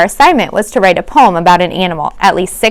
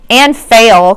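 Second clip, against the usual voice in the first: ordinary delivery, nothing marked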